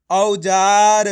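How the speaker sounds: औजार in one loud, stretched word; blended syllables. Shown as a demonstration. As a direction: join औ-जा-र emphatically, holding the जा. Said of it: "मिलके क्या बनेगा".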